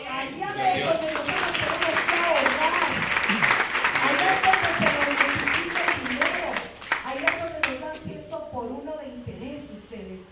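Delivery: loud, high, shouted exhortation; full-force preaching. Real, not acted. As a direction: cry it out to the whole congregation. (predicación a gritos, con fervor)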